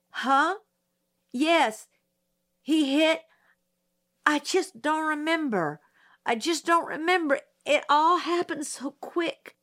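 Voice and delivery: put-on a flustered girl fighting back tears; near tears. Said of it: "Don't you cry, young woman".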